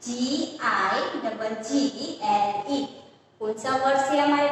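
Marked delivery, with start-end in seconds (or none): 0.1-2.8 slow spelling. Shown as a demonstration. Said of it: "next one is".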